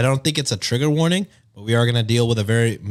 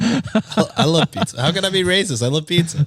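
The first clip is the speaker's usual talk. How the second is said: higher-pitched, laughing mock indignation; laughing mock protest; 6 semitones higher than usual. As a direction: protest jokingly through giggles. Joking mock defense through laughter.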